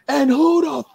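high, loud, drawn-out challenge; slow high-pitched yell of outrage; cussing fit. Retold or imitated. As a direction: imitate a furious boss turning on a stranger. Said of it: "cussing up a storm".

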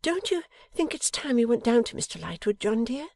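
high-pitched coaxing question; hint it gently in a high wifely voice; insinuating wife. A narrator's reading. Wife nudges husband to go down to the guest, insinuating. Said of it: "Bella insinuated".

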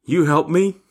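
put-on high, rising child's plea; childlike faith. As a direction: ask it like a trusting little child. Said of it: "with childlike faith".

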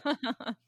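"syllable" as sil-LAB-le; wrong-syllable stress. Shown as a demonstration. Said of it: "The wrong emphasis on the wrong".

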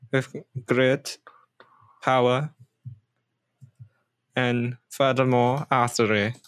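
put-on halting villain threat, lightly German accent; vaguely German accent. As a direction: proclaim doom in a vague German accent, with long pauses. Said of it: "but it sounds vaguely German".

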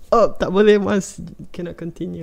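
high, loud squeaky imitation of a friend; imitating Iman. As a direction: mimic her cutting the call off. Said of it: "lepas tu dia cakap".

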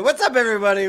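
high, loud show opening; podcast host intro. Acted. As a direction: greet the listeners like a host.

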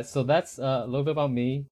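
usual speaking voice

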